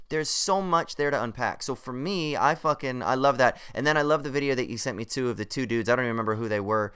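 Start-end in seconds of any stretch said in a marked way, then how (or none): none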